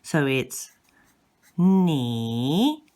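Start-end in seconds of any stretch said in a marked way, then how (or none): 1.6-2.8 long "ni" dipping, then climbing back up; drawn-out "ni" with a fall-then-rise tone; third tone. Shown as a demonstration. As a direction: swoop "ni" down and up again. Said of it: "The third one is the same".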